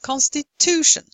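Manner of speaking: constiTUtion, TU high and strong; teacher's demo, stressing TU in "Constitution"; stress before -tion. Shown as a demonstration. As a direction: punch TU in "Constitution". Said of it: "the syllable before shin".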